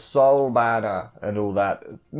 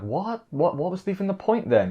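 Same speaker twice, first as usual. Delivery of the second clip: higher-pitched mock exasperation; imitated annoyance; 8 semitones higher than usual. Reviewer retells Shinra learning he must wait three years, imitated annoyance. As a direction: voice his annoyed protest, pitched up. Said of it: "Shinra's getting all annoyed".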